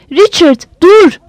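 yüksek sesle haykırış; shouting stop. Acted. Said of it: "diye haykırdı Leslie".

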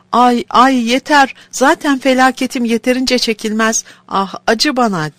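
high, loud pained cries; crying out in pain. Acted. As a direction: cry out under the whip. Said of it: "diye haykırmış".

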